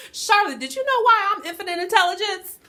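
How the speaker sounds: high, loud, laughing through the words; laughing universe. Pretend. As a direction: tease like a sassy universe, cracking up.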